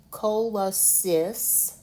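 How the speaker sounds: "cola cis" said slowly, each syllable pulled apart. Shown as a demonstration. Teacher sounding out a medical word for students, slowly by syllable. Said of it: "I'm going to break it into syllables".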